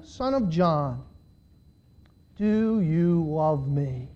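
low, soft, very slow question; grave questioning. A narrator's reading. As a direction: ask it gently, drawn out and low. Third, grave question, read aloud.

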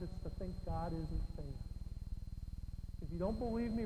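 normal talking voice

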